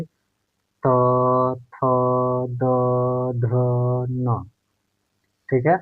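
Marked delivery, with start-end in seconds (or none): 0.0-4.5 त, थ, द, ध, न said one at a time with pauses; spelling out consonants. Shown as a demonstration. Teacher demonstrating dental consonants one by one. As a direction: sound out त थ द ध न slowly, tongue on the teeth. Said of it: "उच्चारण करके देखिए".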